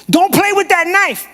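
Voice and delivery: high, loud scolding yell; parent yelling. Retold or imitated. Parent warning a child off a knife, yelling. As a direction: yell it like an alarmed order. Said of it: "Never yell at your children".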